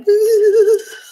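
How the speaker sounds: high, loud giddy "ooh"; long high-pitched squeal; bubbly squeal. Shown as a demonstration. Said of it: "then I get all bubbly and like".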